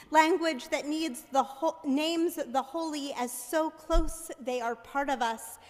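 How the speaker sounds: ordinary delivery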